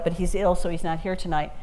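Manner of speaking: ordinary delivery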